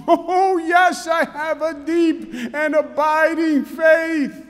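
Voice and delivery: high, loud shout of faith; frantic eager yes. Acted. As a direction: shout it up to heaven, desperate. Falling man eagerly affirms.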